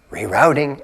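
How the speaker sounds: quiet tender rerouting; gentle. Pretend. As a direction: soothe it like a kind guide. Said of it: "very gently".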